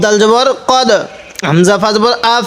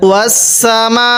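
Quran recitation; slower than usual. Shown as a demonstration. Teacher recites verse after spelling.

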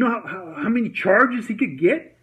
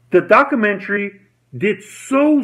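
normal talking voice

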